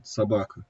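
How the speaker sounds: "sabakı" with the first O said as a full A; akanye, O as A. Shown as a demonstration. Teacher shows students "sabakı" with the O as A. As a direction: model "sabakı", unstressed O turned into A. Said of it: "Son A sesini kaybetmiş".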